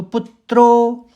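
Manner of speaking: कुंती पुत्रो slow, पुत then त्रो; stressed syllables. Shown as a demonstration. Teacher demonstrates the second quarter's stresses. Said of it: "पहले पुत निकलेगा".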